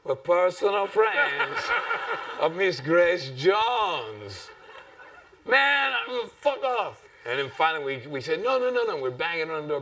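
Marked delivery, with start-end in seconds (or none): 0.0-4.5 high, slow, laughing insistence; pleading insistence. Retold or imitated. 5.5-7.0 much higher, louder fuck off; angry shout. Retold or imitated. Doorman shouting again. 8.4-9.0 desperate pleading. Retold or imitated. Pleading at the door.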